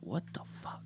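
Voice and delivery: whispered disbelief; awed whisper. Acted. Producer whispering on finding a subwave, stunned whisper. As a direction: whisper it under your breath, voiceless. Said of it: "you're like".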